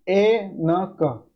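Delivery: ए न क read slowly, letter by letter; sounded out slowly. Shown as a demonstration. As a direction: spell it out for the class, one sound at a time. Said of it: "इस तरीके से पढ़ा जाएगा इसको". (धीरे-धीरे, एक-एक अक्षर)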